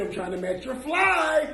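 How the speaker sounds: usual speaking voice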